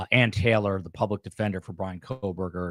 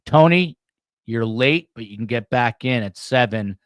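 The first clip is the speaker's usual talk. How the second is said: calling out; 3 semitones higher, 7 dB louder than usual. Real, not acted. Calling out to a late chatter.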